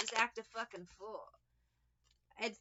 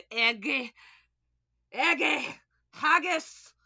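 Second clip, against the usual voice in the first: Iggy and Haggis in a loud, higher mock Scottish accent; 4 semitones higher, 12 dB louder, slower than usual. Reader trying out her only Scottish words, mock Scottish accent. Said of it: "things I can say with a Scottish accent".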